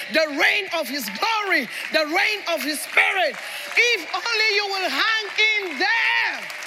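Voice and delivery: fervent, ringing sermon delivery; preacher in full prophetic flow; full-force preaching. Real, not acted. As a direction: proclaim it forcefully to the whole congregation.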